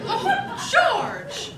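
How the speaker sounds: shocked. Acted. Mother shocked at his remark.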